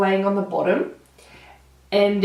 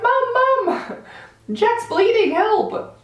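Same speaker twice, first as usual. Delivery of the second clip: high panicky calling out to Mum; calling for help; slower than usual. Retold or imitated. To Mum about bleeding Jack, high panicked calling. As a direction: shout for Mum, pitch way up in panic.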